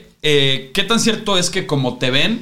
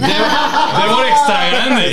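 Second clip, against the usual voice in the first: laughing tease; 9 semitones higher, much slower than usual. Acted. Teasing nickname echo.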